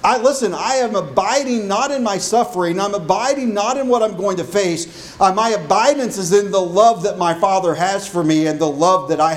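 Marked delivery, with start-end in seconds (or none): none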